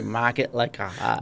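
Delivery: lightly done Massachusetts accent. Shown as a demonstration. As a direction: say it with a Boston-area Massachusetts accent. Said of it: "our Massachusetts accents when we say".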